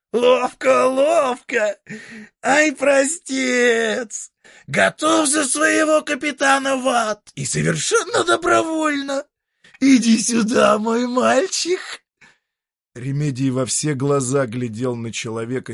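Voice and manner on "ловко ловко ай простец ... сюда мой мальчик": high, creaky devil voice; a creaky, mocking old devil.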